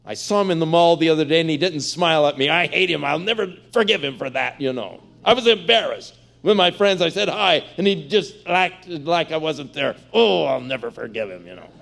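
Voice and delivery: mock the petty hurt of a snubbed churchgoer; petty grudge-holder. Preacher mocking a churchgoer's petty mall grudge, whiny indignation.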